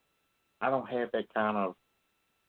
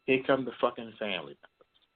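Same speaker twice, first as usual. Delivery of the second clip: ordinary delivery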